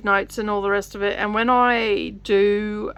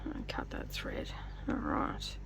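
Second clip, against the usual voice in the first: whispered to herself; softly, focused on the task; whispering; 14 dB quieter than usual. Real, not acted. Finishing the stitch quietly.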